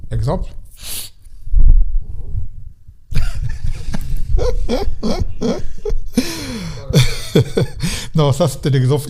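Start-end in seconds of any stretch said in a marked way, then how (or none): 0.6-8.1 long, high fit of laughter; real laughter. Real, not acted. Laughs at an ironic example.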